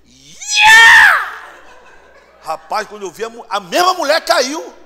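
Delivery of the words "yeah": long, very high, loud scream; piercing scream. Retold or imitated. Woman screaming at the back of church, piercing cry. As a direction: imitate a possessed shriek from the back. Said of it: "um grito assim".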